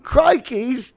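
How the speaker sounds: very high squeaky dog with a Crocodile Hunter twang; Crikey dog voice. Pretend. Host mocks the dog as a Crocodile Hunter fan in a squeaky voice. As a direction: yap it like a cartoon dog shouting crikey. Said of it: "some highbrow British accent with you".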